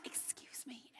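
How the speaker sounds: hushed, polite whisper; a timid excuse-me breathed under the breath. Retold or imitated. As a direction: whisper it so you don't interrupt anyone. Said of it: "he's like".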